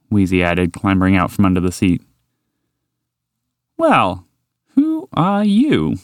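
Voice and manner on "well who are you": slow, high, courteous question; polite inquiry. Acted. Horse politely asking a stranger's name, courteous and slow. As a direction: ask politely, stretching it out high. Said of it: "the horse asked politely".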